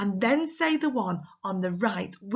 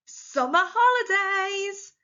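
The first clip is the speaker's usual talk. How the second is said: high, loud, drawn-out cheer; excited; 11 semitones higher, 5 dB louder, slower than usual. Shown as a demonstration. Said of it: "they're surprised or they're excited".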